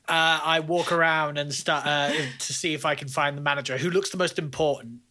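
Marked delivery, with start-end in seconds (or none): none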